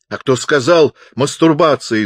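high, loud indignant question; roaring. A narrator's reading. Pupser protests into the megaphone, roaring. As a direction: bellow the question back. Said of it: "заревел он".

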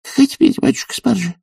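very high servant woman's voice; high-pitched female character; protesting maid. A narrator's reading. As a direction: squeak out a baffled objection. Maid protests absurd order.

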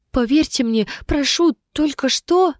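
put-on high-pitched, urgent young soldier; frightened pleading. A narrator's reading. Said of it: "испуганно зашептал юный солдат".

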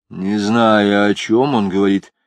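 ordinary delivery, nothing marked

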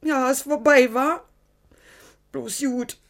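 high, pleading, voice lifting on each question; begging to be told it is over; anxious reassurance-seeking. Acted. Irmela begs Frankie to agree it's over, anxious and high.

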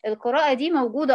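ordinary talk

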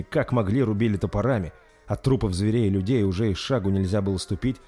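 usual speaking voice

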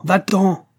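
high, loud shout of va-t'en!; shouted order. A narrator's reading. Sick master shouts at his servant to leave, loud. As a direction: drive him away with a piercing cry. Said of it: "lui cria le malade".

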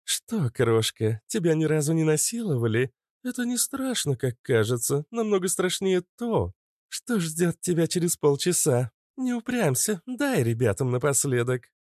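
high, mocking villainess voice; taunting nymph. A narrator's reading. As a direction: toy with a captive in a high, swooping voice. (высокий издевательский голос нимфы)

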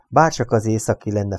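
plain reading voice

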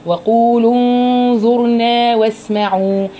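slow chanted recitation; Quran recitation. Shown as a demonstration. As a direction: recite it drawn out. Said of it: "انتبهوا لكلمة انظرنا".

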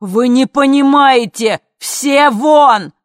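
slow, high, desperate bellow; very loud, frantic shout; yelling. A narrator's reading. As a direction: roar it at everyone. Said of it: "заорал он".

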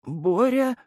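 high, thin startled question; confused high-pitched deputy; bewildered waking. A narrator's reading. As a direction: pipe up as if just slapped awake. Deputy woken by a slap.

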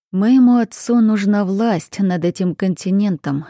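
ordinary delivery, nothing marked

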